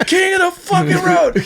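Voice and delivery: high-pitched mock yell of recognition; imitated shouting. Retelling how a fast-food worker yelled at him, shouting. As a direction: repeat the stranger's loud holler. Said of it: "he's, like, shouting it out loud".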